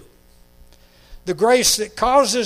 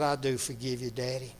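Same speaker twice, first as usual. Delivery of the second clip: soft forgiveness; 5 semitones lower, 8 dB quieter, faster than usual. Retold or imitated. Daughter forgives father.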